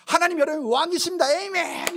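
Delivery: high, loud imitation of a worshipper; imitated ringing congregational shout; noisy congregation. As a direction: mimic someone crying out an amen. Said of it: "말씀 중에 시끄러워요".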